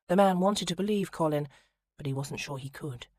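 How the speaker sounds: plain reading voice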